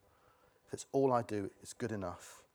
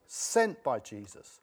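normal talking voice

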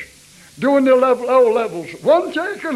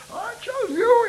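mock divine voice, pitched much higher; imitating God's voice; 11 semitones higher than usual. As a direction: echo how God said it to you, lifted high. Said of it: "never heard anybody speak like".

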